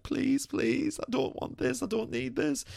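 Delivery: high, strained sobbing plea; retold crying plea. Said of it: "I was crying my eyes out".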